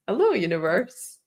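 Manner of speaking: ordinary talk, nothing marked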